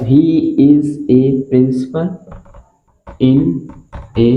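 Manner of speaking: is a principal said very slowly; slow dictation. Shown as a demonstration. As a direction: drag each word out for writing. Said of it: "इस तरीके पे".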